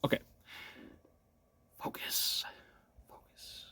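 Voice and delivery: hushed whisper to oneself. Real, not acted. Player steadying himself before starting the game, whispering. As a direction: whisper it very softly, fading away.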